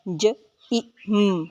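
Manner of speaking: each sound of ज ई म said separately. Shown as a demonstration. Teacher sounding out the word gym, separated sounds. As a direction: say ज, ई, म slowly, one sound at a time. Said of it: "इन सब में वाई की sound ई निकल रही है".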